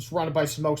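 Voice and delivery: plain reading voice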